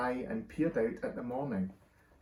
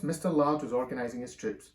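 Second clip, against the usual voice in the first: ordinary delivery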